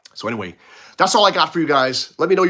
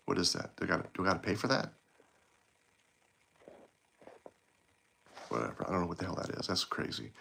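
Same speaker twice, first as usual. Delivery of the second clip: muttered aside; 7 semitones lower, 15 dB quieter, faster than usual. Real, not acted. Muttering to himself at popup.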